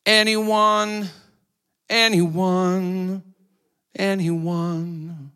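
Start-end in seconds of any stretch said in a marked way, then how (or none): none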